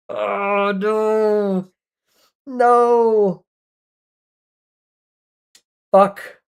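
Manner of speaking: high, pained cry of no; anguished wail. Real, not acted. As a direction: wail it like the round is lost. Losing a round, wailing.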